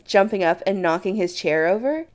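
ordinary delivery

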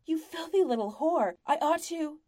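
furious shrieked insult, cut off; scream. A narrator's reading. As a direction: screech it out in blind fury. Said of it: "the merchant screams".

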